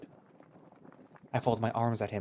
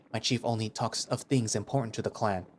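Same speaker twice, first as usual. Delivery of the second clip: usual speaking voice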